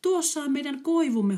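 high, loud joyful cry; a boy spotting his home birch at last; exclaiming. Acted. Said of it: "huudahti poika heti". (riemukas huudahdus)

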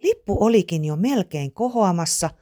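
ordinary delivery

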